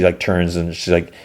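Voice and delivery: ordinary delivery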